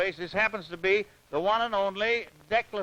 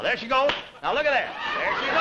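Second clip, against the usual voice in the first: excited; 5 semitones higher, faster than usual. Real, not acted. Host calling the race start.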